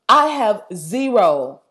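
ordinary talk, nothing marked

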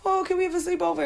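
high, quick imitated child's plea; pleading little girls. Daughters' friends ask mom for a sleepover, mock pleading. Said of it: "nine little girls in front of me crying".

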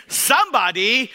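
loud hype shout for the crowd; rally speech. Real, not acted. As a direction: pump up the congregation. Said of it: "my lung power test".

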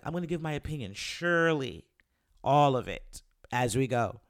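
a hint of a bold vlogger voice; imitating Trey King. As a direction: lightly play the blunt critic, punching "all of it". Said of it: "I'm gonna quote Trey King from YouTube".

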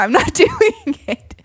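slow, high refusal broken by laughter; laughing no way. Real, not acted. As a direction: refuse while giggling. Refusing to hold fish.

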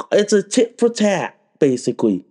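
normal talking voice

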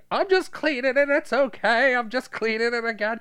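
very high, loud flustered protest; hammed-up innocent insisting; mock defensive excuse. Forgetful old man caught with his gun, mock defensive.

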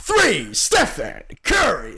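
imitated slow, restrained announcer; current arena announcer. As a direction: stretch each word, without the scream. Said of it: "och nu är det bara".

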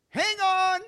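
very high, very loud cry. Acted. God shouting to his struggling children, very high. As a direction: shout it to reach them. God urging patience, repeated louder.

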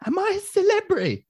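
high, loud mock-starstruck question; mock surprise. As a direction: squeak it like a stunned fan. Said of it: "imitating own reaction".

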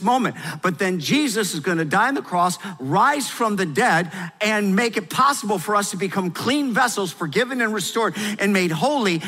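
loud, high, fervent preaching; preaching at full force. Acted. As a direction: preach it out like a revival.

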